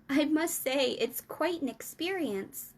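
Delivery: a hint of a little girl marvelling; Sophie voice. A narrator's reading. Sophie tells the giant, a light girl voice.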